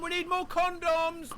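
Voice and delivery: high, drawn-out call across the house; retold child's yell. Child calls to mum for supplies, high yell. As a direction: imitate a kid hollering to mum. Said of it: "my neighbours just heard me yell that out".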